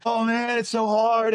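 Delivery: high, whiny mock lament; mocking his own tearful self-pity; mock crying. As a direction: overplay the poor-me sob story. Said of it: "And I'm crying".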